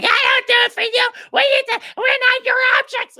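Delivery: high shrill mock protest; mocking feminist shout. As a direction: screech it like an offended activist.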